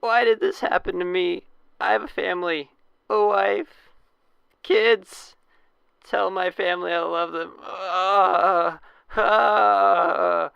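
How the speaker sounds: high, loud dying wail; wounded soldier gasping. Acted. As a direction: moan it out like a dying man. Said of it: "he's really getting into it".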